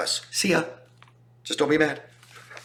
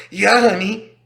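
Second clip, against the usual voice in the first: high, louder appeasing yes; placating; 8 semitones higher, 5 dB louder, slower than usual. Retold or imitated. Partner to controlling spouse, mock appeasing yes.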